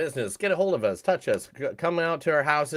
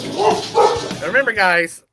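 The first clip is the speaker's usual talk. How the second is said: high, slow, hammed-up announcer build; playful over-the-top announcer tease; mock announcer; 8 semitones higher, 7 dB louder, much slower than usual. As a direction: stretch it out like a grand sign-off. Mock dramatic outro line.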